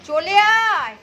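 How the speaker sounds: loud high come here; calling out. Real, not acted. Owner loudly calls Jimmy to come. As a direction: summon the dog loudly.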